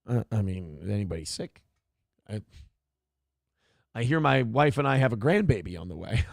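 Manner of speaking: a hint of an unbothered father; clueless dad. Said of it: "And you're like".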